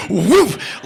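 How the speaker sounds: loud whooshing "woof"; imitated leap noise. Iggy leaps onto the amps, loud whoosh. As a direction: sound effect of a big jump.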